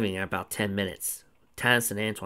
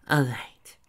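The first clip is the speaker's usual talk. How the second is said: suddenly serious; 4 semitones higher, 4 dB louder than usual. A narrator's reading. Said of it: "suddenly serious".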